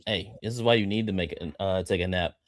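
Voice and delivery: usual speaking voice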